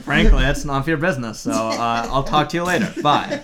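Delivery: low, loud laughing male voice; deep voice, laughing it off; Fredericks voice. Acted. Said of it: "I have his voice".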